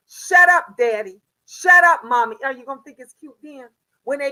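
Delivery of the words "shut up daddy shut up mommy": loud mocking shut up; mock bratty kid. Mother mimicking a rude child to parents. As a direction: throw it back like a rude child. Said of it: "when she tell you".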